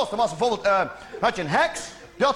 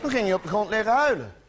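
usual speaking voice

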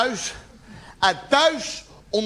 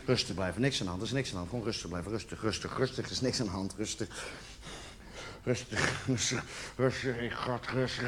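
very low, hushed repetition; quiet, low anxious mumbling; muttered self-calming; 13 semitones lower, 14 dB quieter, faster than usual. Acted. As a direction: talk yourself down under your breath. Muttering calm to himself, anxious.

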